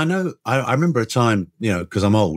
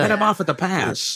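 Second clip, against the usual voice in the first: put-on higher-pitched playground American accent; 7 semitones higher than usual. British speaker recalling kids playing cowboys, in a mock American accent. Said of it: "do it with American accents".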